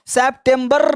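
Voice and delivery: सितंबर as "sep-tem-barrr", slow; September with hard r. Shown as a demonstration. Teacher showing learners what to avoid, rolled-r सितंबर. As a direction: roll the final r of English सितंबर, slowly. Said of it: "सितंबर नहीं".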